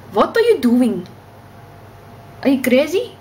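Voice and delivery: high, loud Indian-Irish accent; Indian accent slipping into Irish. Shown as a demonstration. Demanding what a friend is doing, muddled Indian-Irish accent. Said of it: "I sound kinda Irish".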